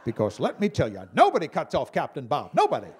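loud, tough pilot bravado; tough-guy pilot. Pretend. Comic giving Captain Bob a macho boast, loud bravado.

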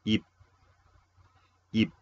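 "ip" as one short, isolated syllable; teacher modelling "ip", cut short with a pause after; model pronunciation. Shown as a demonstration. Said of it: "Vamos a pronunciar". (pronunciar "ip" como modelo, sílaba corta y aislada)

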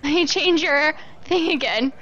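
normal talking voice

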